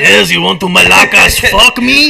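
loud, slow, hammed-up Greek accent; over-the-top Greek disco come-on; mock Greek accent. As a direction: bellow it in a silly Greek accent. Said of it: "that's Greek disco".

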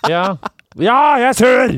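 high, loud mock sulky shout; openly sulky. Answering the boss, openly grumpy mock shout. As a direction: declare the sulk proudly. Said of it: "var sånn åpent sur".

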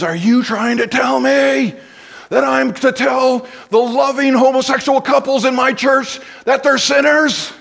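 high-pitched outraged scream in another voice; screamed retort. Retold or imitated. A pastor screaming at the speaker, outraged and high. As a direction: scream it back like the angry pastor did. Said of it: "he screamed at me".

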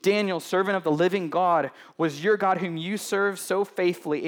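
king calling anxiously into the lions' den. Acted. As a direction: call out to someone out of sight. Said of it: "he called out".

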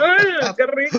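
mouth full. Real, not acted. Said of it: "Perdón que estoy comiendo".

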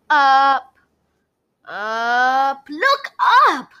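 building from high call to very high loud cry; girl spotting the answer overhead; excited discovery. A narrator's reading. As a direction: realise it and shout it.

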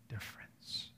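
sudden breathy whisper. Real, not acted. Preacher to congregation, whispering for emphasis. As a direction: drop the word to a whisper. Whispered climax of sermon point.

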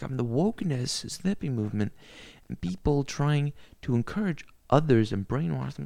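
usual speaking voice

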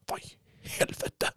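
imitated irritation under the breath; hushed irritated swearing. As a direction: whisper it like an annoyed runner. Mimics an irritated runner's reaction.

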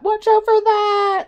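escalating warning that jumps to a piercing yell; panicked dad. Retold or imitated. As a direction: imitate a panicking dad, building to a high, loud shriek. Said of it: "my dad was a panicker".

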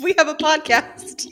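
high, laughing words; after laughing hard. Real, not acted. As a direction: say it through laughter. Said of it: "Okay, my cheeks hurt".

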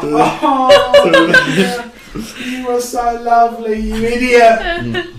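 squeaky high I promise, half laughing; high pleading promise. Acted. The elf promises her dead mate to spend the coins wisely.

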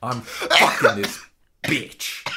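hammed-up impression of a celebrity; Billy Crystal voice. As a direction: drag it out in a lightly put-on celebrity voice. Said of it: "I was just be doing Billy Crystal there".

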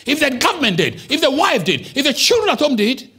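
loud, high, driving sermon shout; shouting preacher. Real, not acted. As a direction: build the congregation up with a shout. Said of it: "please don't tell me I'm shouting".

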